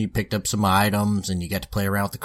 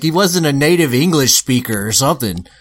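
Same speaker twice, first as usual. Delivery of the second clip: ordinary talk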